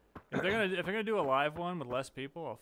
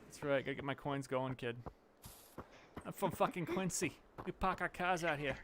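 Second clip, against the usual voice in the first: put-on Boston townie; hammed-up Boston accent. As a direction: boast like a Boston local. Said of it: "It's like a little bit of Bill Burr".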